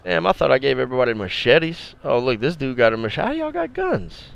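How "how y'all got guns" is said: shocked squeal. Real, not acted. Shocked at armed crew.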